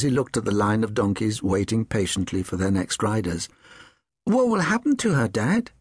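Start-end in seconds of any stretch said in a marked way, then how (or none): 4.2-5.7 put-on high-pitched boy's question; worried son. Son asks his dad what will happen to Jenny, worried. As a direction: ask it high, as an anxious child.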